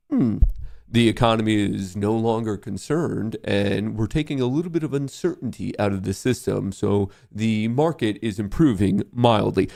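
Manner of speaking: a hint of a monotone news pundit; mocking boring anchor. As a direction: mimic a sleepy TV analyst. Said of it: "why they all talk like this".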